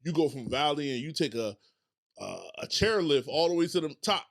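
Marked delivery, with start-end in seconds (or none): none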